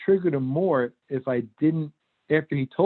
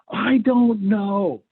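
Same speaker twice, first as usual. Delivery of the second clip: higher, very slow mock yell; retold yell back; 8 semitones higher, 3 dB louder, much slower than usual. Son yelling back at his dad, mock yell. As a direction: fling it back like a cornered kid. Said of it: "and he yelled it".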